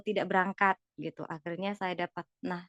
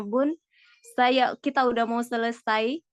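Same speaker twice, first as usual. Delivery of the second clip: ordinary delivery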